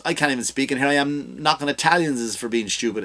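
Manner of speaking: normal talking voice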